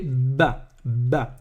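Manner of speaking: "ba" said slowly with a held, strong b; doubled consonant. Shown as a demonstration. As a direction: press hard on the b of "ba". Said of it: "c'est voyelle double".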